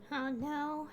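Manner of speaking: usual speaking voice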